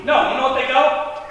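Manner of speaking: ordinary talk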